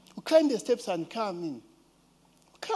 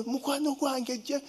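put-on little girl's voice; 7 semitones higher, 5 dB quieter than usual. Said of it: "a little girl say".

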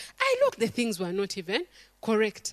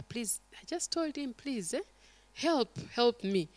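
soft, begging voice; desperate plea; 9 dB quieter than usual. Retold or imitated. Mother begging the pastor to help her child, softly. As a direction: plead with him quietly. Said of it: "I just told her".

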